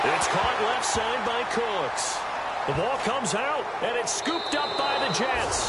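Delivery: high, rising excitement as the play turns; urgent sportscaster voice, lifted high; excited play-by-play. Real, not acted. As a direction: call the fumble live, pitch climbing. Live call of fumble recovery.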